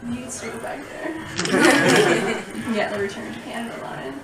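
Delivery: very soft, coaxing thought; the little voice in your head, hushed; quiet inner voice. Acted. Driver's own mind nagging after the border, very quietly. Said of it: "in the back of my mind".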